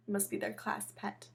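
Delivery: usual speaking voice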